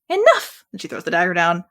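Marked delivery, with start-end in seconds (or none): 0.1-0.6 high, loud sharp shout; shouted enough. Acted. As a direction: cut everyone off. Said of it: "bad impression voiceover".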